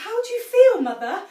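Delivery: high, loud anxious question. A narrator's reading. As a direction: ask fearfully after stammering. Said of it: "asked Missus Cranky anxiously".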